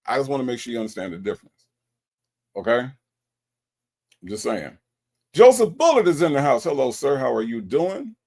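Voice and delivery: ordinary talk, nothing marked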